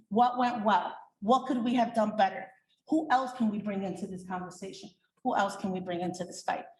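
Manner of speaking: ordinary talk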